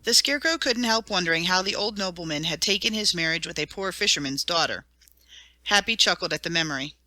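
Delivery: ordinary delivery, nothing marked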